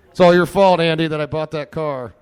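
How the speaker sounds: loud, high mock blame; shouting playful blame at Andy; mock accusing shout. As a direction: yell the blame at your friend. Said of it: "He doesn't hear me".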